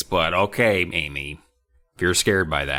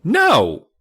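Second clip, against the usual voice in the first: frightened no; 10 semitones higher, 6 dB louder than usual. Acted. Amy refusing.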